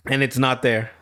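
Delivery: usual speaking voice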